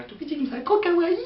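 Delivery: high, squealing gush, building loud; cutesy squeal. Pretend. Vlogger gushing over a tiny cute character, squealing. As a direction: gush like a fangirl over something adorable.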